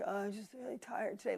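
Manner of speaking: soft, whiny mock tired kid; a touch of a mopey child. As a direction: mimic a lazy kid's excuse. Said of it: "I don't like my kids, like".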